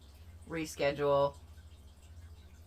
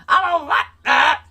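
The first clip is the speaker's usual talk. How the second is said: high, loud, mock-indignant whine; mock outraged squeal; 10 semitones higher, 12 dB louder than usual. Complaining to viewers that mosquitoes like her again, mock outraged squeal. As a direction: squeal it like a spoiled kid. Repeats complaint, louder and higher.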